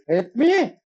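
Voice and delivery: "Help me" yelled high and loud; desperate call. Real, not acted. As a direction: cry out "Help me" as if dying in game.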